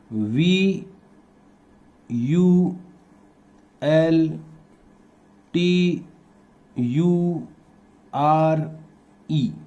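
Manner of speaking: drawn-out letters, evenly spaced with pauses; slow dictation. Shown as a demonstration. Teacher spelling vulture for pupils writing along, slow dictation. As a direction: leave time to write each letter.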